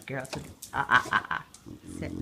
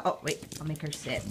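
ordinary talk, nothing marked

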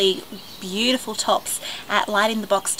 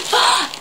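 small gasp; 10 semitones higher, 4 dB louder than usual. Real, not acted. Opening the bag.

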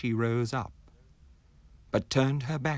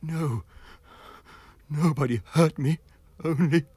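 higher, louder shaken reply; frightened man; 7 semitones higher, 6 dB louder than usual. Acted. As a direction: stammer out the denial in fear. Terrified man answers.

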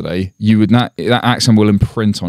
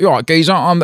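higher, hammed-up Cockney British accent; mock British accent; 8 semitones higher than usual. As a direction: do a bad British geezer accent. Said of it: "and he's like".

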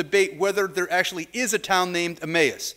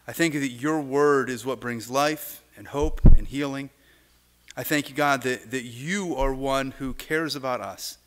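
prayer; 6 semitones lower than usual. Real, not acted. Pastor leads opening prayer.

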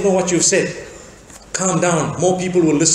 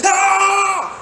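very high, drawn-out imitated holler; mock fighting yell; 12 semitones higher than usual. Man replying to a shouted greeting, hammed-up high yell. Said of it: "as though they are fighting each other".

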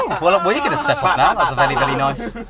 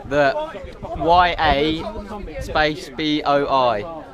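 spelled out slowly; much slower than usual. Shown as a demonstration. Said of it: "No, I'm not saying it".

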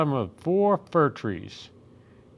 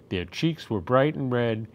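usual speaking voice

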